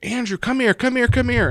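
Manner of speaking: imitated grandma calling from another room; high, loud, urgent summons. Grandmother calls him into room.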